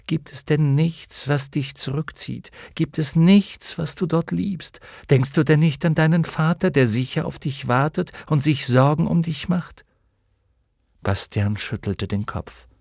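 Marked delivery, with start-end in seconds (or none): 0.1-9.8 high, earnest appeal; almost pleading. A narrator's reading. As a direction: press him with imploring questions. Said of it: "sagte Atreo fast bittend". (fast bittend)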